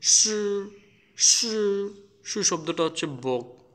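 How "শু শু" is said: শু said higher, tongue curled back; retroflex shu demo. Shown as a demonstration. Teacher showing students the retroflex শু. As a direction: model শু with the tongue tip raised. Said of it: "And also we can say".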